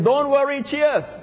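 high, bright mock toast; playful high cheers. Preacher jokes to the church, high playful cheers.

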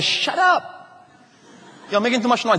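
high, sharp hushing rebuke; scolding. Acted. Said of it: "the disciples say".

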